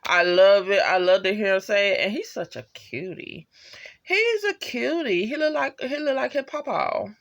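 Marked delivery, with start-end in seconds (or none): 4.0-7.1 cooing. Real, not acted. Fan gushing over a cute character, high-pitched cooing.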